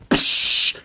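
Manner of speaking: breathy psh for shattering glass; glass break noise. Retold or imitated. Imitating the glass shatter before Stone Cold enters. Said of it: "and you hear".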